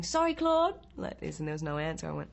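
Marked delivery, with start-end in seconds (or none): 0.0-0.7 high, loud apologetic call. Retold or imitated. Actress calling an apology to her neighbour through the wall. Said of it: "I'm going".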